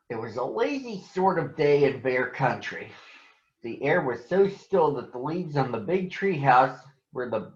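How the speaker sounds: gentle storybook narration; reading to children. A narrator's reading. Reading a Berenstain Bears book to schoolchildren, gentle storytelling. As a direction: read it like a picture book for kids.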